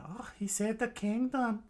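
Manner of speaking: acted falsetto exclamation; Nami. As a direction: put on a high girl's voice at normal volume. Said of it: "Nami said".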